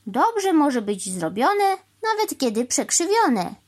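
slow, high sing-song couplet; rhyming retort. A narrator's reading. Cow answering the crow in rhyme, slow lilt.

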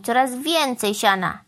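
usual speaking voice

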